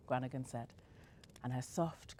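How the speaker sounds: usual speaking voice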